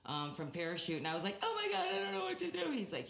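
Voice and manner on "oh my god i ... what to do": high, rushed panicked retelling; hammed-up fluster, high and quick; retold panic. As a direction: reenact freaking out on the phone, pitched high and fast. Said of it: "like, I'm freaking out".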